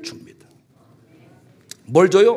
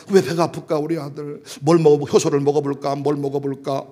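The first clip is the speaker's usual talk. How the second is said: lower, softer mock-doting mother; 9 semitones lower, 5 dB quieter than usual. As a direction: coo over the son like an indulgent mom. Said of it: "지 엄마는".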